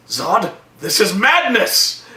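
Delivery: a lightly voiced solemn father; mock pleading. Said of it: "Jor-El is like".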